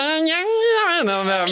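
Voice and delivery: high, drawn-out strangled monster voice; Rick. Acted. Imitating the hormone monster from Big Mouth, strained monster voice. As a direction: sound like throwing up while talking. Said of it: "the one hormone monster from Big Mouths".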